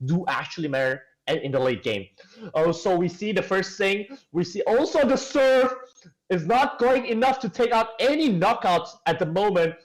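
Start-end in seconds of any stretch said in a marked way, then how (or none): 4.6-9.7 high, loud rising caster call; excited play-by-play. Acted. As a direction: shout the first knockout with building excitement. Hyped call of knockout.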